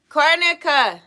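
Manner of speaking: loud, high shout of a name; real scolding call; calling out sharply. Real, not acted. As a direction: holler across to the next room. Calling out to someone off-mic.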